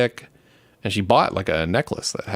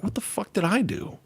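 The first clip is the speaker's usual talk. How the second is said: higher, softer, baffled cop voice; a light take on a bewildered detective; McNulty; 11 semitones higher, 6 dB quieter than usual. Retold or imitated. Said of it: "That guy McNulty, he's like".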